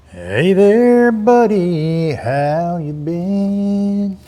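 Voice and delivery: drawn-out pretend chat with a buddy; mock catch-up with a buddy. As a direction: play it like a lonely guy greeting a long-lost friend. Chatting to an imagined old buddy.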